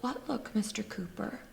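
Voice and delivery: hushed, mock-innocent delivery; retold challenge. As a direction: drop right down to a near-whisper for the retold comeback. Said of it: "And I'm like".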